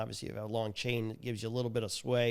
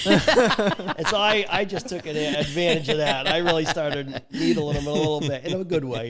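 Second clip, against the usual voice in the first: higher and louder, laughing through the words; 5 semitones higher, 12 dB louder than usual. Real, not acted. Telling podcast hosts about needling a contractor, laughing. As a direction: chuckle as you tell how you needled him.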